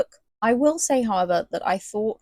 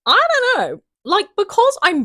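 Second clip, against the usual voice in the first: much higher, louder giddy gush; 5 dB louder than usual. Real, not acted. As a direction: squeal it out, smitten. Gushing over the English hero.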